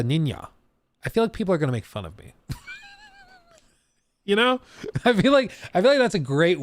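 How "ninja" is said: "ninja" softened to nin-ya; attempted authentic pronunciation. Streamer imagining himself using the correct word, authentic-style pronunciation. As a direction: put a y in "ninja" in place of the j. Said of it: "actual correct way of saying it".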